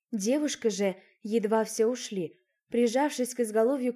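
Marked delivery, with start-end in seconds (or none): none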